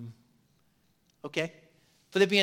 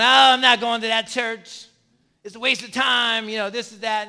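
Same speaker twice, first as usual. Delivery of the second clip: louder, dismissive complaint about church; grumbling churchgoer; 4 semitones higher, 6 dB louder than usual. Retold or imitated. Mock churchgoer complaining about going to church, dismissive. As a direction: brush off church as a waste of time.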